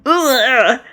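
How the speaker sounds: sudden voiced growl; violent growl. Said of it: "gave a violent growl".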